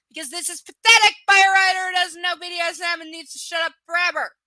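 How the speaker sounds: loud, high, fast angry outburst; furious rant. Real, not acted. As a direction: tear into the author at full volume. Ranting at the writer.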